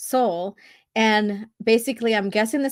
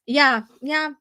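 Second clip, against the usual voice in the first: usual speaking voice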